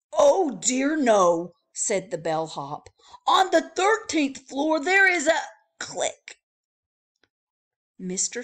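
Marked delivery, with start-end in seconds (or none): 0.1-1.5 loud alarmed protest. Acted. Bellhop alarmed. 3.3-5.4 loud, high hurried warning; urgent warning. Acted.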